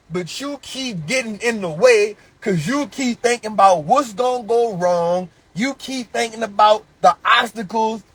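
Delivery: loud, driving sermon delivery; fired-up preacher hammering his message; full-force preaching. Acted. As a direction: preach it hard at the crowd. Preaches loudly to his audience.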